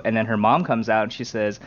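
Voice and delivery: ordinary talk, nothing marked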